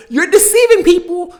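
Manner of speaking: loud, high accusation of deceit; indignant outburst. Real, not acted. Yelling at Netflix for its misleading trailer, outraged.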